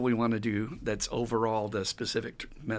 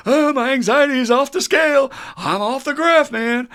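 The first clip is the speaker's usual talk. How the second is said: much higher, louder frantic voice; panicked client; 12 dB louder than usual. Retold or imitated. Newcomer tells counselor he is panicking, frantic high voice. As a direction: play the panicking newcomer, pitched way up. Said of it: "start off saying".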